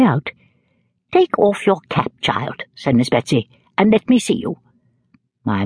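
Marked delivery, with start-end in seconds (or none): 1.1-2.6 put-on slow, higher stern command, softening on child; imperious old lady, slow and deliberate; Miss Betsy. A narrator's reading. Betsy ordering the young mother. 3.8-4.6 put-on higher, stern old lady; lightly voiced imperious aunt; Miss Betsy. A narrator's reading. Betsy ordering the young mother.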